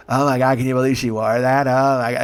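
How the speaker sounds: mock gossipy wedding guests; gossiping. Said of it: "they start, uh, gossiping".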